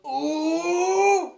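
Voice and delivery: loud, high mock squeal of surprise; high surprised squeal. Woman yelps after Rufus slaps her butt, high squeal. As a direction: yelp it like she's been goosed. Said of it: "She goes".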